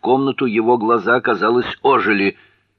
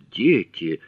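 newly interested. A narrator's reading. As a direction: take the word in with interest, near normal pitch. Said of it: "но сейчас он заинтересовался".

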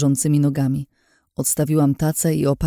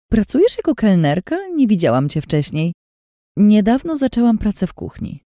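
voiced dialogue; 7 semitones higher than usual. A narrator's reading.

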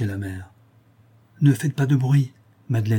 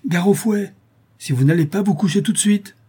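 high, raised scolding threat; terrible threatening voice; 9 semitones higher, 4 dB louder than usual. A narrator's reading. Son-in-law threatening the old woman with whip, terrible. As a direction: thunder a warning, pitched well up. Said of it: "de sa voix la plus terrible".

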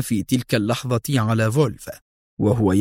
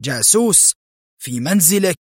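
higher-pitched, questioning gasp of alarm; alarmed astonishment; 6 semitones higher than usual. A narrator's reading. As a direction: ask it as a startled narrated exclamation. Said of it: "بدا أشبه بشهقة فزع". (بدهشة أشبه بشهقة فزع)

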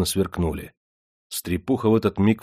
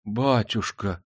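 gasped dismay; 4 dB quieter, much slower than usual. A narrator's reading. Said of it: "ахнула стрепуха".

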